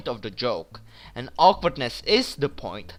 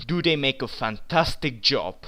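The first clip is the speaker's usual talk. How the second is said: usual speaking voice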